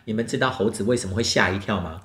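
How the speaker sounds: usual speaking voice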